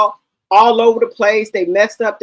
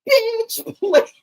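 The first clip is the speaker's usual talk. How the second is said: bitch please; 11 semitones higher than usual. Real, not acted. Dismissive retort to the song.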